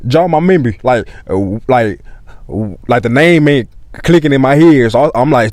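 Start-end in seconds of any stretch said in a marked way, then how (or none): none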